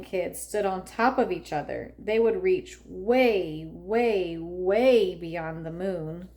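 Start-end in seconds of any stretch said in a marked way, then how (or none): none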